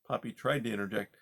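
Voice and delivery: ordinary delivery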